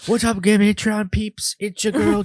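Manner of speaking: high, drawn-out adoring cheer; mock peasant army. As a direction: imitate a crowd of peasants hailing their god. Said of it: "your peasant army saying".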